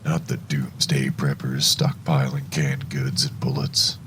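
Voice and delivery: usual speaking voice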